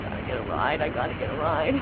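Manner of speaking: low, quiet self-talk; muttering to herself. Acted. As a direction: mutter it under your breath. Muttering to herself after rejection.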